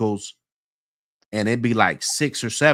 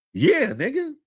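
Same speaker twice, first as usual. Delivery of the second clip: a sharp, much higher "yeah"; defiant reply; 12 semitones higher than usual. Retold or imitated. Answering the new inmate's challenge, defiantly high. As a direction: toss the answer back in his face.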